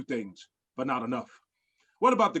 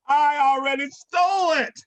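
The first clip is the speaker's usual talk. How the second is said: high, loud triumphant cackle-shout; villain laughs and shouts his triumph; gloating villain shout; 10 semitones higher, 6 dB louder than usual. Acted. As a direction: crow over the hero.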